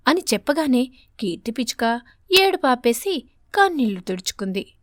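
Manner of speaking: usual speaking voice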